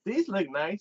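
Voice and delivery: high-pitched mock admiration; imitated eager suitor. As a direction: play a keen date window-shopping.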